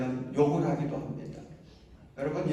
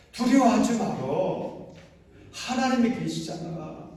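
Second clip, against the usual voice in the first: higher, louder comforting of oneself; self-encouragement; 7 semitones higher, 5 dB louder than usual. Shown as a demonstration. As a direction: tell your own soul not to fear. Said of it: "좀 자신에게 이야기해 주세요". (자기 자신에게 높고 크게 다독이듯)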